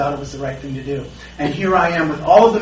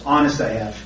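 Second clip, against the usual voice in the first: ordinary delivery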